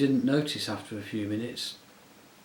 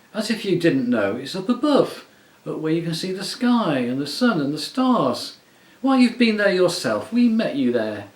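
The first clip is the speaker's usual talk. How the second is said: higher and louder, insisting hard; heated protest; 7 semitones higher, 8 dB louder than usual. A narrator's reading. Scrub insisting to the witch that Overworld is real, heated. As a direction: argue back, indignant and rising.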